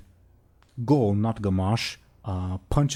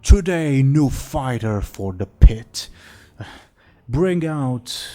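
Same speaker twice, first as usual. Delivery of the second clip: loud, slow arena proclamation; ogre announcer; 4 semitones higher, 8 dB louder, slower than usual. Acted. Golgamash addressing the crowd at the fighting pit, loud announcing. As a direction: boom it out to silence a crowd. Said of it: "calls for silence and says".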